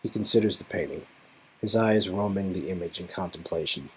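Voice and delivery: soft, fast scene-setting narration; quiet narration. A narrator's reading.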